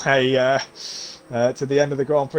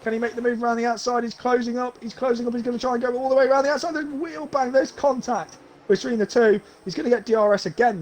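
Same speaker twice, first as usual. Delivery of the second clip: excited; 7 semitones higher than usual. Real, not acted. Close battle for the lead.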